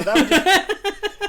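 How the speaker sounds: cracking up mid-sentence; laughing. Real, not acted. Laughing at bad service story.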